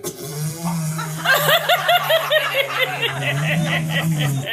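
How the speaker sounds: a long burst of laughter; rolling laugh. Real, not acted. Laughs at own remark.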